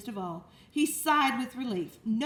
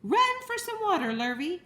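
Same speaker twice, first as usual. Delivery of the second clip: higher, louder calls for water; urgent shout; 7 semitones higher, 5 dB louder than usual. Acted. Farmer shouting at Lurvy to fetch water, urgent. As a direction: yell to the hired man.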